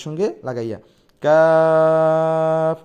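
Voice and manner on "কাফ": কাফ drawn very long and loud; light Arabic letter. Shown as a demonstration. Teacher leading students to repeat কাফ. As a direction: stretch কাফ out slowly. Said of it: "জিহ্বার গোড়া হতে একটু আগে".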